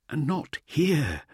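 high, slow, forlorn voice; dismal. Acted. Uplander realising he is not back in his dream, slow high dismal tone. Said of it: "the Uplander added dismally".

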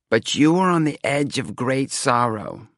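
slow, stagy warning of doom; portentous fortune-teller. A narrator's reading. Seer warns young man of great sorrow, portentously. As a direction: foretell it with grand, slow emphasis. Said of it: "doing the old emphasis thing".

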